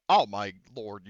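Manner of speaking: slow, loud gasp of disbelief; stunned reaction. Real, not acted. GM sees the crit result, stunned and loud. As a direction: drag it out in shock.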